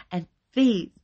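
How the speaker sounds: loud, high, venom-filled accusation; venomous. A narrator's reading. Wife points out the culprits to police, venomous. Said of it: "she said with venom in her voice".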